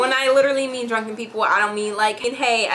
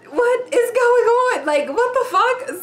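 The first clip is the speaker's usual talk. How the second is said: bewildered; 11 semitones higher than usual. Retold or imitated. Woman caught in a drunk tug of war, high slow bewildered cry. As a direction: cry out, utterly lost. Dragged around, confused and scared.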